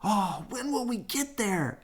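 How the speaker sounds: high, impatient cub voices; eager cubs. A narrator's reading. Cubs asking Papa from the car, high and impatient. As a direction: whine it like kids in the back seat. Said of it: "asked the cubs".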